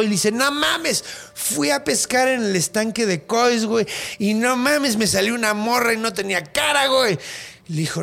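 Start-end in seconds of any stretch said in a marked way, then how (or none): 0.3-7.2 panicked husband blurting out his story; pitched up panic, gasping out the news; frantic retelling. Retold or imitated. Scared husband retells ghost.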